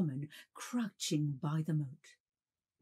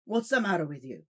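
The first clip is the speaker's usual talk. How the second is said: gruff, louder questions; rough questioning; 3 semitones higher, 5 dB louder than usual. Acted. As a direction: demand an answer roughly. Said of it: "roughly exclaimed".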